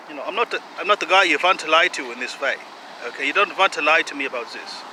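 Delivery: mock German accent, indignant lecture; hammed-up aggrieved German character. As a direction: scold the relatives in a put-on German accent. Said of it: "courage to do a German accent".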